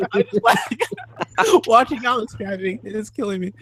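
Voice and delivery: high-pitched, talking through giggles; real laughing fit. Real, not acted. Telling co-hosts why Alex's driving is killing them, laughing. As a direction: struggle to get the words out through laughter. Cracking up watching friend drive.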